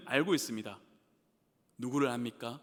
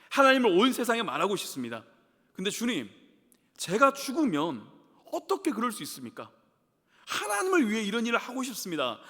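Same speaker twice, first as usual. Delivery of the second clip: higher and louder, demanding answers from God; pleading protest that climbs in pitch and volume; sarcastic accusing prayer; 5 semitones higher, 5 dB louder than usual. Acted. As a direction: confront God like a wronged servant. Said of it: "하나님께 이렇게 비꼬는 기도처럼".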